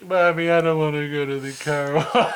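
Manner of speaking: put-on high, loud whining child; hammed-up kid wail, dragged out; scared kid. Imagined kid begs mommy after watching, mock whine. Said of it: "imagine kids seeing this on T V".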